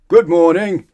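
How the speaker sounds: loud, high booming greeting; bellow. Acted. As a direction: boom it at the customer. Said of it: "bellowed the grocer".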